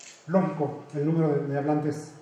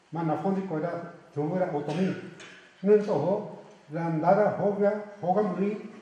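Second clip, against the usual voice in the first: a measured reading voice, a little raised, in Otomi; read aloud in Otomi; 4 semitones higher, slower than usual. Shown as a demonstration. Lecturer reads Quixote opening in Otomi to his audience, raised reading voice. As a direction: read the opening passage so listeners hear Otomi sounds. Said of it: "Me permití leerles la primera parte de Ñamñú".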